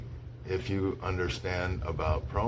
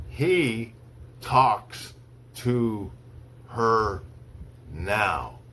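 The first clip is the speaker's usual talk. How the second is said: slow, one word at a time with long pauses; slow dictation; much slower than usual. Shown as a demonstration. Teacher reads to students for dictation, slow, word by word. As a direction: read it word by word so they can write. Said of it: "I'll say each sentence slowly three times".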